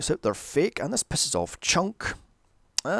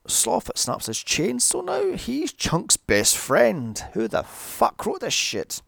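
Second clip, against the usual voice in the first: ordinary talk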